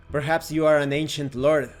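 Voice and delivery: ordinary delivery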